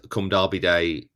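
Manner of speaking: normal talking voice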